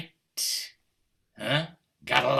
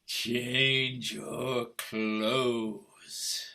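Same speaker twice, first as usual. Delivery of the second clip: slow menace; slower than usual. Acted. Man drawls menacing command.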